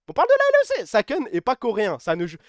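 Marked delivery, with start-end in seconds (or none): 0.1-0.9 "L E C" repeated in a squeal far higher and louder; indignant high shriek at the league; mock outraged squeal. Shown as a demonstration. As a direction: jump way up and squeal "On parle de la L E C".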